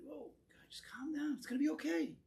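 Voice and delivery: hushed whisper: whoa, easy now; soothing whisper. Retold or imitated. Teacher acting out calming a panicked worker, in a hushed whisper. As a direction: whisper to him like you're calming a spooked horse. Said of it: "if you tell the guy".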